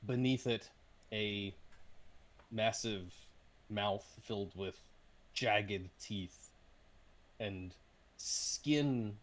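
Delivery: slow, deliberate monster reveal with heavy pauses; game master narration. Acted. Game master reveals the creature to Ryland, suspenseful narration. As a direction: draw out each detail for suspense.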